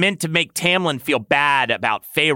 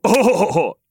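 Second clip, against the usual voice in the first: higher, louder acted laugh; 6 semitones higher, 5 dB louder than usual. A narrator's reading. Lucian reacts to the lover, laughing. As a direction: laugh out loud in character. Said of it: "Lucian laughed".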